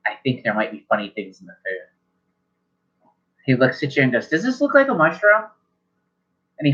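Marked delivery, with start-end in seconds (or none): 0.0-3.1 low wary mutter. Acted. Evan voiced at dinner. 4.3-5.4 high puzzled question. Acted. Evan voiced holding fork.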